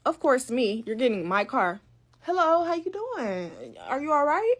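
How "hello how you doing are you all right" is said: lightly voiced slick guy greeting the girl, slowed and higher; a hint of an overly polite smooth talker; fake friendly cousin. Boyfriend sweet-talking the girl in the car, mock polite.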